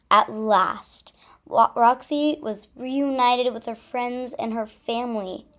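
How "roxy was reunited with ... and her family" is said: ordinary delivery, nothing marked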